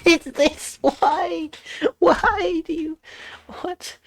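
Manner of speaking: high-pitched exasperated laugh; exasperated squeal. Real, not acted. Exasperated at game.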